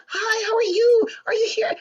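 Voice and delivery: mocking the earlier high cheerful voice; friendly greeting. Son contrasts her first greeting, mock high and cheerful. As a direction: squeal it falsely cheerful. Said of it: "It's not".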